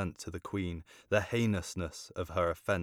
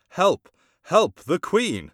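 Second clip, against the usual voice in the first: high, loud, slow shout for help; cry for help; 11 semitones higher, 12 dB louder, slower than usual. Acted. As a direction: call out in alarm from behind the curtain. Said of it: "cried out".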